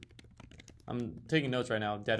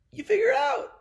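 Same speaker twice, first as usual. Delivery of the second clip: very high, pinched silly line; squeaky mock voice; 7 dB louder than usual. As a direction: toss it off in a squeaky cartoon voice. Mocking advice on swimming.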